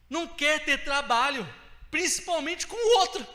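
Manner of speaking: preacher hammering the point home, voice climbing; preaching loud. Real, not acted. Preacher warning the church, loud and high.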